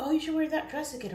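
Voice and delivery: high, loud gushing request; mock an enthusiastic fan; imitating eager viewers.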